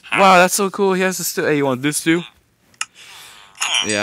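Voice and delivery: ordinary talk, nothing marked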